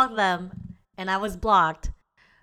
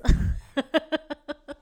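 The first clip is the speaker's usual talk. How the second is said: laughing; 6 semitones higher than usual. Real, not acted. Laughs at own insecurity.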